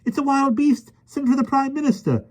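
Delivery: very high fretting lady; flustered panic. A narrator's reading. Miss Lark panics at the beast, very high fluster. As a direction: fret helplessly.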